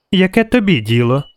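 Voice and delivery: put-on high, defiant child voice; acted high-pitched huffy child; sniffling little girl. A narrator's reading. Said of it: "шморгнула вона".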